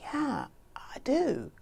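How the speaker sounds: slow, hushed realization; whispered admission. Acted. Admitting to herself what her mind said, slow whisper. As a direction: breathe it out slowly in a whisper. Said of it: "like that".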